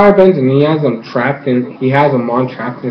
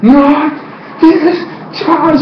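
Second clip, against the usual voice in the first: high, slow, menacing hero line; hammed-up defiant villain; villain threat; 12 semitones higher, much slower than usual. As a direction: spell it out word by word, high. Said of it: "he's like".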